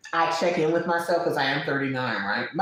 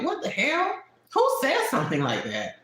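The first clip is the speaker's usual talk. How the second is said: incredulous; 9 semitones higher than usual. Real, not acted. Outraged question.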